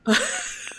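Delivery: high soft squeal of laughter; high squeaky laugh. Real, not acted. Streamer joking about ugly crying, high squeaky laugh.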